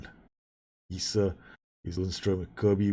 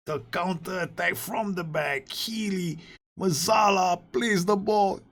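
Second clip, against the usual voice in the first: high, thrilled play-by-play; excited commentary; 12 semitones higher than usual. Real, not acted. Gamer recapping his team's goal, thrilled.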